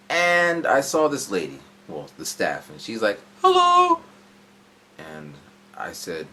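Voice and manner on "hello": very high, loud sing-song shop greeting; overly cheery hello. Retold or imitated. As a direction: chirp it like an eager shop clerk. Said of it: "that hello was too, it was too familiar".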